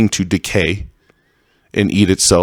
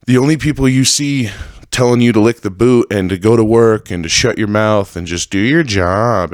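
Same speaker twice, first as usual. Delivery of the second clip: ordinary talk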